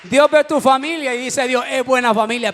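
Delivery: preaching at full force. Acted. Pastor to his church, preaching at full force, loud and high. Preaching climax on God improving.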